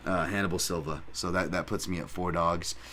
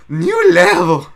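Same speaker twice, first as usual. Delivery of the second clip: much higher, louder impression of Hannibal; loud over-the-top Hannibal impression; 10 semitones higher, 14 dB louder, slower than usual. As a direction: belt it out in Hannibal's own voice. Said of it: "Sorry, that's how he talks".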